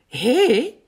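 high, loud hail; calling out. A narrator's reading. Schaap spots Big through the window, calling out. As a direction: call out to a friend. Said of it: "roept Schaap".

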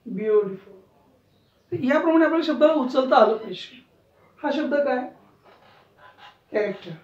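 ordinary delivery

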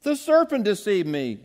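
sudden loud high cry of blame; Eve blaming the serpent. Retold or imitated. Preacher voices Eve blaming the serpent, loud and high. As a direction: point the finger hard. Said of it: "The woman said".

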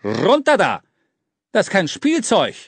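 hoch und laut angeblafft; barscher Befehl. A narrator's reading. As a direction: scheuch ihn streng herunter. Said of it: "blaffte er den Kleinen an". (laut gebellter Befehl)

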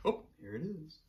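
ordinary delivery, nothing marked

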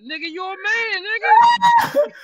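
high, loud yell through laughter; real laughing shout, voice going way up. Real, not acted. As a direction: shriek it while cracking up. Cracking up at the reveal.

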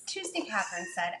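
usual speaking voice

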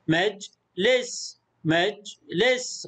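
slow مجلس as "maj ... les"; split into syllables. Shown as a demonstration. Teacher sounds out the word for pupils, slowly split. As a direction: pause inside مجلس after "maj", both times. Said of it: "المقطع الساكن".

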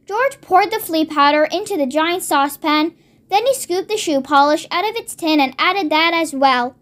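ordinary delivery

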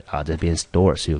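normal talking voice

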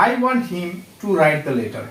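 very slow, soft, careful reading; slow dictation. Shown as a demonstration. Teacher dictating an example sentence to students, slow and soft. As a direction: read the sentence word by word for the class. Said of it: "reading example sentence to class".

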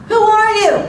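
high, loud acted call; confused yell. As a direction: yell it out like a puzzled boy. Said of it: "looked confused and yelled".

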